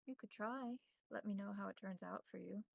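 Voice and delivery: ordinary talk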